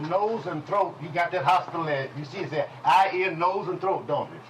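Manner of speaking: ordinary delivery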